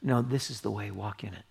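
quiet, fading guidance; voice of God. Retold or imitated. God guiding the listener from behind, soft and fading. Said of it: "hear the voice behind you saying".

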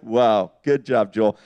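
low, deadpan mock praise; dry self-reproach. Dad chiding himself for his prank, low dry sarcasm. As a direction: congratulate yourself sourly, pitch dropped.

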